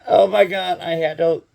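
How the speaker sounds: loud, high laughing outburst; laughing exclamation. Real, not acted. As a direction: blurt it through laughter. Laughing reaction to the joke.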